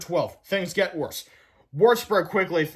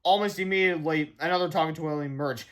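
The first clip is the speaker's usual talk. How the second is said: usual speaking voice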